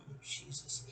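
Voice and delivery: whispered under her breath; hushed groan at her own slip; embarrassed whisper. Real, not acted. Slipping a friend's name on air, whispered dismay.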